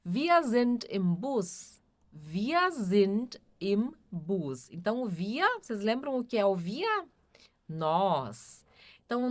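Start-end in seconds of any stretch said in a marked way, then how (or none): 2.1-4.7 very slow model sentence, words separated. Shown as a demonstration. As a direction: spell it out slowly for learners, pausing between words. Teacher repeats sentence word by word. (bem devagar, palavra por palavra)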